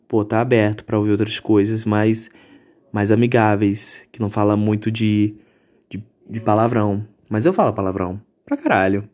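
ordinary talk

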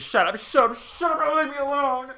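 very high, loud scream; Sarah screaming at Della in the lunch room. Retold or imitated. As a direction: scream at the ghost to stop. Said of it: "And Sarah screams".

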